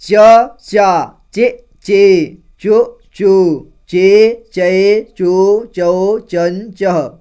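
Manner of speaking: each vowel called out loud and clear, with pauses; loud syllable drill. Shown as a demonstration. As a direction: recite the vowels slowly for the class. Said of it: "अब च का देखिए". (ज़ोर से धीरे-धीरे स्वर बोलना)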